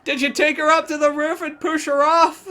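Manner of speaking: loud, high hammed-up accusation; exaggerated high-pitched interrogation; mock girl reporter. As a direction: squeal wild accusations like an overeager reporter. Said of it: "she's really going far with the journalism thing".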